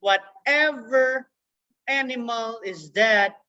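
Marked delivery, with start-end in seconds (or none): none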